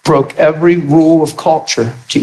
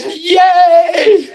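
high excited shout; high joyful whoop; cheering. Retold or imitated. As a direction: cheer with joy. Cheering the healing.

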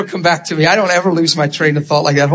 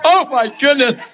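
high, slow, laughing exclamation; helpless laughter; 8 semitones higher than usual. Real, not acted. Preacher lost in the pulpit, cracking up. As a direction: gasp it out through laughter.

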